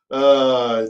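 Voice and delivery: ordinary talk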